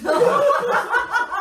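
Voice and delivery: high, loud burst of laughter. Real, not acted. Host laughing at the Ghostbusters joke, loud high laugh. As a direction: crack up at the joke.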